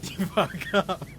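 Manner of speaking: words dissolving into laughs; laughing helplessly through the phrase; breaking into laughter. Real, not acted. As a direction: try to say it and crack up. Breaks up laughing at own story.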